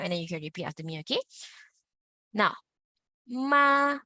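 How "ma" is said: MA held high and perfectly level; high flat tone. Shown as a demonstration. Teacher modelling the first tone, high and flat. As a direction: sing out MA on one high, flat note. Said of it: "I'm gonna repeat slowly".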